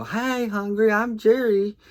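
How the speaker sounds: very high, loud singsong hello; mock introduction. Uncle teasing whoever says they're hungry, mock introduction. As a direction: belt out a joke greeting way up high. Acting his dad-joke greeting.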